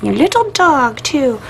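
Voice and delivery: high cackling witch voice; Wicked Witch. Retold or imitated. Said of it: "I sound like, um, the Wicked Witch out of Wizard of Oz".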